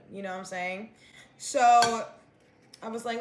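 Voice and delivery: ordinary talk, nothing marked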